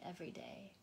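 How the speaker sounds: breathy whispered close. Acted. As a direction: let the phrase fade into a whisper. Soft close of devotional line.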